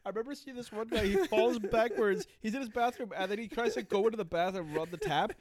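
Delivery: high, loud, laughing retelling; rushing through a wild story; excited. Real, not acted. Excitedly retelling fire challenge video.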